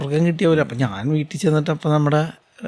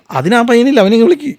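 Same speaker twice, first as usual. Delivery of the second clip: loud, higher call to fetch someone; retold call across the set; 8 semitones higher, 7 dB louder than usual. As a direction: shout the order across the set. Crew calling for the boy.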